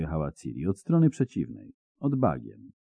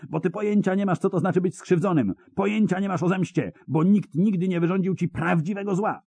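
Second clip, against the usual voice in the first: put-on higher, a furious young woman's yell; 12 semitones higher, faster than usual. A narrator's reading. Ciri yelling back at the old hermit, higher. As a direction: shout him down in anger. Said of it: "wrzasnęła".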